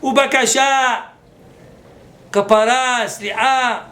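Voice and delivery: loud, high fervent listing; preaching. Acted. Rabbi preaching repentance, loud and fervent. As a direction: call out each word.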